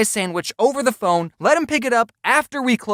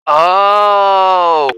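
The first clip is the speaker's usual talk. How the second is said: loud shouted oh; 8 dB louder than usual. Real, not acted. Realizing the caller wants gas, loud oh. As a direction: burst out a loud oh.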